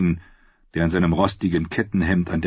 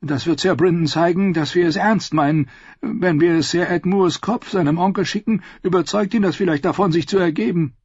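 higher, pushy voice pressing the council; urging; 12 semitones higher, 4 dB louder than usual. Acted. Lord Ammon pressing the war council, higher, urging. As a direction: urge them on, eager for a hanging. Ammon continues his plan.

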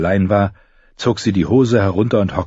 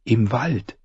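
hoarse frightened whisper; 4 semitones higher, 4 dB quieter than usual. Acted. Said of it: "flüsterte sie heiser und verängstigt".

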